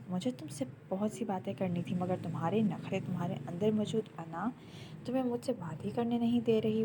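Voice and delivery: ordinary delivery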